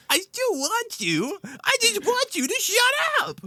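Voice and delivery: high slow cartoon kid whine; Billy voice. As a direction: squeal it like a silly cartoon kid. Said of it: "the one clip that was just like".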